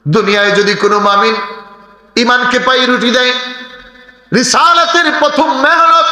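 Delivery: high, half-sung sermon chant; chanted pulpit exhortation; melodic preaching. Acted. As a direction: intone it high, holding the phrase endings.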